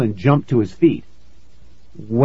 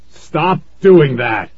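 loud, slow, raised voice of an angry dad; dad screaming; 4 semitones higher, 6 dB louder, slower than usual. A narrator's reading. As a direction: yell it slowly, each word driven home. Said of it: "Dad screamed".

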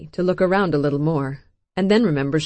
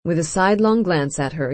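ordinary delivery, nothing marked

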